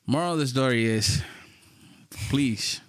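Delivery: usual speaking voice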